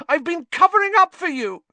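high, loud shriek. A narrator's reading. Hermione shrieks at Lupin, high and loud. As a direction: shriek it at him. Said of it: "Hermione shrieked".

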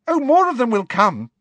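Ginny reassuring Harry, confidently. A narrator's reading. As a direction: toss it off with total confidence. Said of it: "said Ginny confidently".

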